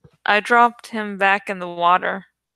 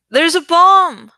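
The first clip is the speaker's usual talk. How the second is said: slow, high, loud scream of alarm; 8 semitones higher, 8 dB louder than usual. A narrator's reading. Narrator screams to the shoppers, full-lung alarm. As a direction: yell it to clear the store. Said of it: "I screamed at the top of my lungs".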